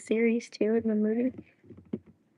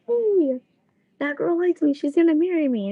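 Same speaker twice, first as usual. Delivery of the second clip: high, loud, gleeful boast; giddy Zenitsu; 6 semitones higher, 5 dB louder than usual. Retold or imitated. Boy brags to his friend that the girl likes him, giddy and loud. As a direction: brag with boyish glee that she likes you. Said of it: "He's like".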